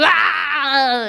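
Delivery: short loud yelp; mock scream. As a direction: let out a sudden scream. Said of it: "and just go".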